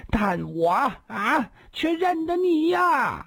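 high, drawn-out desperate cry; hoarse cry. A narrator's reading. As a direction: wail it out slowly, rising high. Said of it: "嘶声地说道". (嘶声呼喊)

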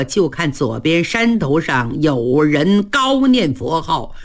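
usual speaking voice

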